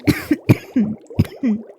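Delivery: sudden sharp coughing fit; harsh cough. Acted. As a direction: cough as if fumes catch the throat. Marie coughing over smelting fumes.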